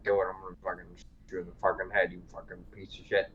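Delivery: hushed, fast menacing quote; mock quiet threat. Soldier threatening his reluctant comrade, hushed and fast. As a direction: rattle off the threat low and quick.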